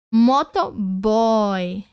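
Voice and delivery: Motoboy stretched out very slowly, the oy gliding; teacher sounding out Motoboy very slowly; very slow demo pronunciation. Shown as a demonstration. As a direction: drag out Motoboy so the Y glide is heard. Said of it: "Quando tiver apoiada em uma vogal".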